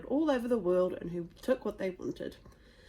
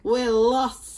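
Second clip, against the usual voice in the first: loud cry of defeat; loud despair; 2 semitones higher, 10 dB louder than usual. Acted. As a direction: bellow that all is lost. Viking chief admits defeat.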